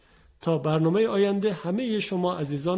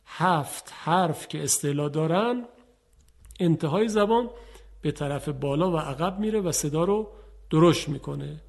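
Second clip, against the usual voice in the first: ordinary talk, nothing marked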